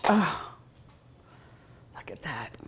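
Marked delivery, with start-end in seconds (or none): 2.0-2.6 hushed whisper of awe. Real, not acted. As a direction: breathe it out in a whisper. Whispering over cookie.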